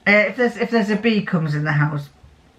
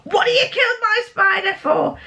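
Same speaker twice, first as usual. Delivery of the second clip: high, indignant scolding; telling off the stepson for squashing her spider; mock telling-off; 13 semitones higher than usual. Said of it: "give him a talking to".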